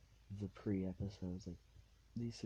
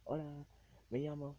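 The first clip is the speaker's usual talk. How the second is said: higher-pitched beginner's Spanish introduction; retold Spanish accent test, pitched up; 9 semitones higher, 4 dB louder than usual. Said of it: "quick little conversation to test out accents".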